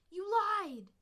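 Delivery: very high, very loud accusing yelp. A narrator's reading. Kit accusing the deputy who broke his promise, shrill loud yelp. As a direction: shriek it at him, betrayed. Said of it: "Alpine Kit yelped".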